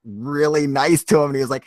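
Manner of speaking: normal talking voice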